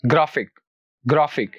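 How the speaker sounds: "Graphic" stressed on ग्रा; second-last syllable stress. Shown as a demonstration. Teacher models the rule, "graphic" with stress on ग्रा. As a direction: hit ग्रा in "graphic", let फिक fall. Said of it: "आखिर से दूसरे".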